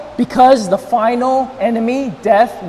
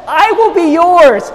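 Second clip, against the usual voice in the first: loud, high, drawn-out I WILL BE YOURS; emphatic divine promise; 7 semitones higher, 6 dB louder, slower than usual. Acted. Preacher voicing God's pledge to his people, emphatic. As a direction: proclaim "I will be yours" slowly, voice lifted. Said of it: "what God is emphasizing".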